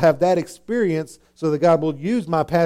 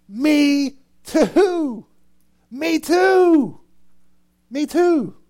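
higher and louder each time; emphatic repeats; 12 semitones higher, 5 dB louder, much slower than usual. Acted. Preacher repeating the powerful words to his congregation, emphatic. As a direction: build the me too.

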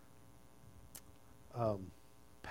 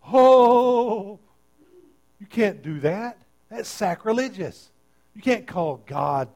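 high, loud mock outrage; a hammed-up prissy objection; scandalised Pharisees; 5 semitones higher, 8 dB louder, slower than usual. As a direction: ham up the shocked religious critics. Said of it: "They're like".

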